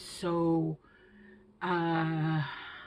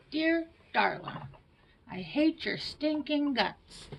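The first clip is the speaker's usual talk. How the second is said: lightly voiced little-rascal kid, higher; a hint of Alfalfa reading his love-hate letter, slow; Alfalfa impression; 8 semitones higher than usual. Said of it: "I look like alfalfa".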